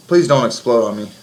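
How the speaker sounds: ordinary talk, nothing marked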